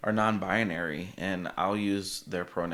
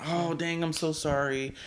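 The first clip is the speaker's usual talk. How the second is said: high-pitched, flustered mock apology; acted apology; 8 semitones higher than usual. Friend apologises for the wrong name, acted apology. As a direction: imitate a friend caught slipping up.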